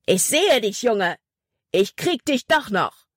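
high, angry threat called across the water; furious bellow. A narrator's reading. Hutton threatens the boy across the water, furious bellow. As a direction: call out a raging threat, pitched high. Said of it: "Seine wütende Stimme brüllte über das Wasser".

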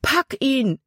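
high-pitched urgent order; called out. A narrator's reading. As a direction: cry it out to someone in the current. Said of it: "rief nochmals".

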